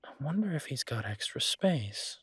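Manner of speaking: hushed whisper, musing; whispering the idea to herself while planning. Acted. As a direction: breathe the thought out as a private aside. Repeats the thought to herself.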